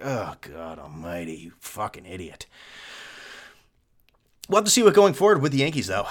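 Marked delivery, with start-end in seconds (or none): 0.0-2.5 low, slow, quiet disgust; muttered exasperation at hypocrites; exasperated. Retold or imitated. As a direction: groan it out at the fan. Exasperated reply to fan.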